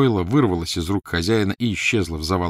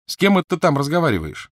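calling through wall; 9 semitones higher, 5 dB louder than usual. Acted. Ron shouts through wall.

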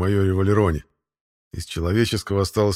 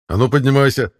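a loud, higher order; raised voice; 6 semitones higher, 7 dB louder than usual. Acted. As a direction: bark it at the fallen soldier. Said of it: "Чуть повысил я голос". (повышенный командный голос)

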